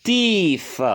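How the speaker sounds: TEETH high, with a stretched ee; long vowel. Shown as a demonstration. Teacher showing the irregular plural, TEETH with long vowel. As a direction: hold the ee in TEETH on a high pitch. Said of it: "Ça devient une voyelle longue".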